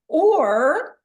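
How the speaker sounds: ordinary talk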